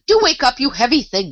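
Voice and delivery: put-on girl losing patience with two sleeping queens, impatient. A narrator's reading. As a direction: wake them, fed up and impatient. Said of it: "in an impatient tone".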